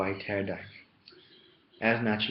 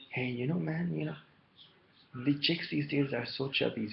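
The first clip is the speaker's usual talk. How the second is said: lightly mimicked commenter, raised pitch; mock complaint in a higher voice; YouTube commenter; 6 semitones higher than usual. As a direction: quote a guy griping, pitched up a little. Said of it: "he was saying that".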